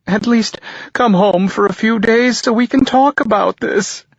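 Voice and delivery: high, tearful mother's plea; a mother bargaining through tears; mother begging her son to visit, high and tearful; pleading mother. A narrator's reading. Mother on the phone.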